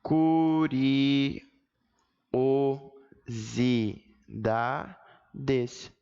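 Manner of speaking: CU-RI-O-SI-DA-DES, very slow with long pauses; separação silábica. Shown as a demonstration. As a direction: stretch each syllable of "Curiosidades", one at a time. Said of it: "quando eu falo curiosidades". ("Curiosidades" sílaba por sílaba, bem devagar)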